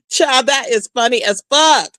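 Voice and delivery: normal talking voice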